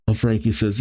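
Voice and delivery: normal talking voice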